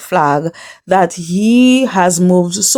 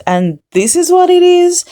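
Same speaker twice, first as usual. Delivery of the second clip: retold disbelief; 12 semitones higher, 3 dB louder than usual. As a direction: imitate a shocked friend, pitch jumping way up. Retelling the girls' shocked reaction.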